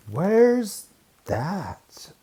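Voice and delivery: usual speaking voice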